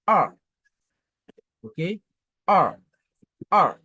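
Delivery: a dropping sharply, loud; fourth tone. Shown as a demonstration. Teacher drilling the falling a loudly. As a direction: cut a downward fast. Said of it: "ton descendant".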